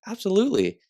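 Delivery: high, loud, emphatic agreement; delighted quote, pitched well up; retold eager yes. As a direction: act out an enthusiastic yes.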